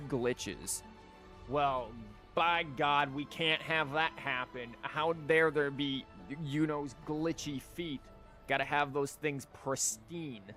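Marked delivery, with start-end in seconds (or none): none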